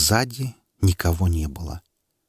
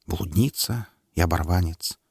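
ordinary delivery, nothing marked

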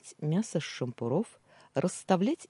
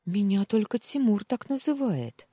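taken aback; 7 semitones higher, 4 dB louder than usual. Acted. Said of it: "опешила сестра".

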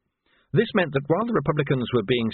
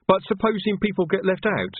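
Reg; 8 semitones higher than usual. A narrator's reading. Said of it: "said Reg".

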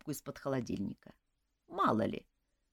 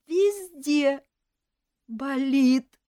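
whining elf; 6 semitones higher, 6 dB louder, much slower than usual. A narrator's reading. Elf whines again.

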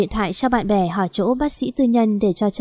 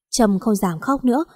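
usual speaking voice

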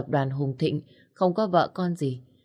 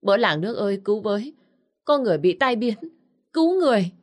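a shout for help that climbs much higher and louder to a scream; desperate shout for help building to a scream; crying for help; 5 semitones higher, 4 dB louder, faster than usual. A narrator's reading. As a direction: yell to the whole village, building to a shrill scream. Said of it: "lấy hết dũng khí và kêu lên".

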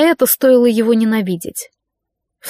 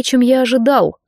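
usual speaking voice

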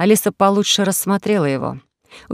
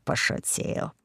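pошутил breathed out, unvoiced and much softer; whispered retreat; 12 dB quieter, slower than usual. Scared host backs down from the parrot, whispering пошутил. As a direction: drop пошутил to a hushed whisper.

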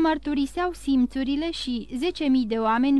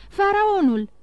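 higher, louder cry of Faraonul; joyful shout; 8 semitones higher, 5 dB louder than usual. A narrator's reading. Call out the ship's name in joy. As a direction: Emanuel bursting in with the ship's news, shouting joyfully. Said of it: "strigă el".

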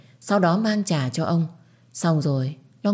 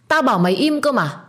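loud, high furious yell; angry shout; 8 semitones higher, 4 dB louder than usual. Acted. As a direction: shout it, losing patience. Bảo orders Linh silent again.

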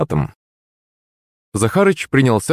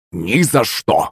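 usual speaking voice

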